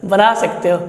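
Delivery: amused teacher laughing as he answers; laughing reply. Real, not acted. Laughing reply to cheeky question.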